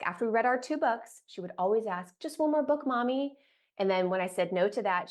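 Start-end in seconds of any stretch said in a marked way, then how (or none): 2.2-3.4 put-on high, whiny little-kid plea; pleading child voice. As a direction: beg like a small child.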